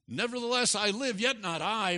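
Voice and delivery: high and loud pulpit voice; emphatic, lifted preaching; fervent scripture declamation. Real, not acted.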